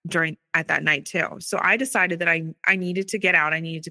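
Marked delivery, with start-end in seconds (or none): none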